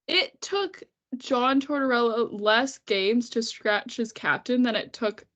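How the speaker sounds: ordinary delivery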